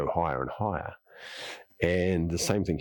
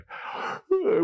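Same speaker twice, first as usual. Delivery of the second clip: sharp, high breathy gasp of a yawn. Shown as a demonstration. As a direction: gasp in like a sudden waking yawn. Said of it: "And you'll yawn and you'll go".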